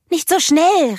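high, loud, anxious call; worried call. A narrator's reading. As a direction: call out in worry for your sister. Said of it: "rief Elsa besorgt". (besorgter, lauter Ruf)

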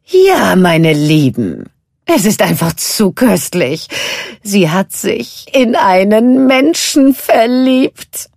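loud, slow relishing witch voice; gloating sea witch. A narrator's reading. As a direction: drip with wicked delight. Ursula reveals the secret. (genüsslich triumphierende Seehexe)

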